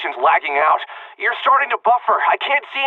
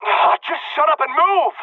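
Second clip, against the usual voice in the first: high, drawn-out exasperation; exasperated order; 8 semitones higher, slower than usual. Acted. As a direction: order them along, fed up. Impatient order to the fleeing girl.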